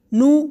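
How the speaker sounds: نو cut short, no n at the end; wrong short no. Shown as a demonstration. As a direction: clip نو without the final n. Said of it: "اس کو غلط پڑھنے کا طریقہ یہ ہے".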